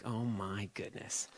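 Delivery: soft, slow "oh my goodness" under the breath; hushed disbelief. Retold or imitated. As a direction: murmur it in quiet shock. Reacting to the raunchy script.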